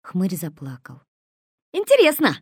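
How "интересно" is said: higher, louder cheery exclamation; bright and mocking; cheerful. A narrator's reading. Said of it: "бодро сказал Косой". (бодро, громко, выше)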